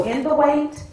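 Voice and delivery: high, loud sermon call; preacher's refrain. Real, not acted. Preacher urging listeners to remember their assignment, emphatic preaching. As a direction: punch it out to the congregation.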